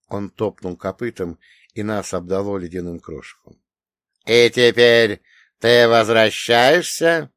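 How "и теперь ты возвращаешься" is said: menacing demon. Acted. Demon's slow menacing question.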